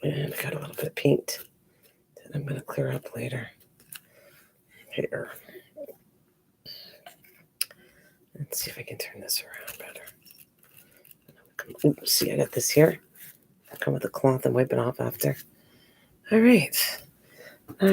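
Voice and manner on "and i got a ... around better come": quick, breathy-free unvoiced whisper; hushed working whisper. Real, not acted. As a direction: murmur it under your breath while fiddling. Whispering while cleaning paint.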